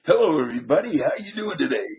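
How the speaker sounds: brassy 1960s radio host hello; sixties DJ voice. Shown as a demonstration. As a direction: greet the listeners like an old AM DJ. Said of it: "nineteen sixties era D J voice".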